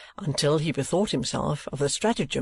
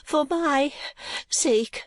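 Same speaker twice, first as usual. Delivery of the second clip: simpering; 12 semitones higher, much slower than usual. A narrator's reading. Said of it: "simpered and hesitated".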